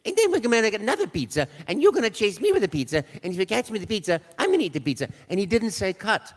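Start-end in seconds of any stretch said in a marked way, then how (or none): 0.1-5.1 high, loud cartoon plumber; Mario voice. Improvising for the camera, high loud Mario. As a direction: keep the silly Mario patter going.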